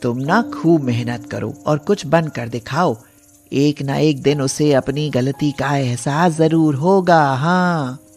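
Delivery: a granny giving firm advice; wise old woman. Acted. (समझाती बूढ़ी अम्मा)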